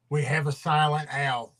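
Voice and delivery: ordinary talk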